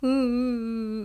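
long, closed-mouth mock sobbing hum; imitated whimpering cry. Daughter crying in mum's arms, imitated whimper. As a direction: imitate a child's muffled, drawn-out crying. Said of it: "她就一直哭".

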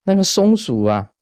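ordinary talk, nothing marked

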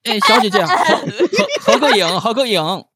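high, loud Beijing hustler; Beijing accent. Pretend. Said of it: "有点北京腔了".

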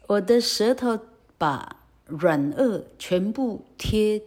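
normal talking voice

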